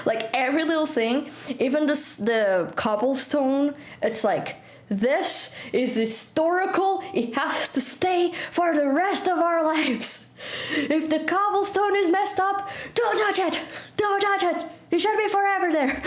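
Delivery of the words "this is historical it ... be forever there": high, indignant mock defence; hammed-up outrage over preserving tradition; mock protectiveness. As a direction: protest like it's sacred, pitched high. Mimics tradition-obsessed Portuguese over cobblestone.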